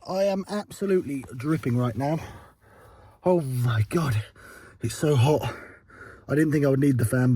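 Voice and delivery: ordinary delivery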